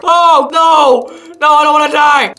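high, loud scream for life; panicked cry. Real, not acted. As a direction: scream as the monster attacks. Attacked in game.

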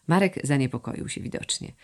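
plain reading voice

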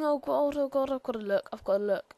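normal talking voice

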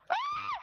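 sudden high loud yelp; high pained yelp. Real, not acted. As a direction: yelp like you just got hurt. Yelp after being tagged.

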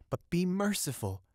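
mildly pleading, lifted high in pitch. A narrator's reading. Soul pleads with the fisherman.

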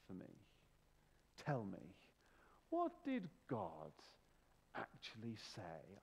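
hammed-up posh voice, big falls in pitch; posh Satan voice. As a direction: play a posh devil with long, coaxing pauses. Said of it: "I imagine he's got a posh voice".

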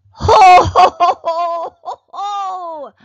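booming ho ho ho; big jolly laugh, fading down; playful jolly laugh; loud jolly laugh. Acted.

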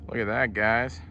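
usual speaking voice

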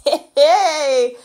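ordinary talk

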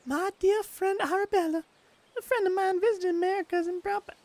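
hesitant high-pitched British lady accent, first attempt; trying out a high-pitched British woman's accent. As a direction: test a high British lady voice before settling in. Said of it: "how do I do a British female accent".

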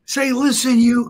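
high, loud angry retelling of a caller; hollering. Retold or imitated. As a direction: imitate a man hollering down the phone. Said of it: "And he's hollering".

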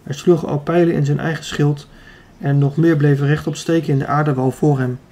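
ordinary delivery, nothing marked

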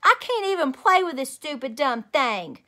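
put-on high, loud childish whine; whiny complaint. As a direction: sulk and whine like a cross little girl. Junie B. whining about toy.

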